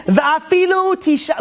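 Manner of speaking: slow, high chanted Hebrew; drawn-out Talmud chant; Hebrew recitation. Shown as a demonstration. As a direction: intone the Hebrew text slowly, like study-hall chant. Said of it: "I really want to say it in Hebrew".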